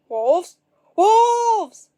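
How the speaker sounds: rising to a high, loud scream; panicked cry. A narrator's reading. Frightened villagers raising the alarm, screaming.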